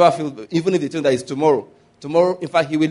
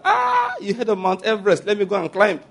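mocking the eager traveller; 6 semitones higher than usual. Mocking a bucket-list dreamer.

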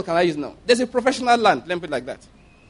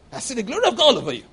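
mock beggar flattery; 4 semitones higher, faster than usual.

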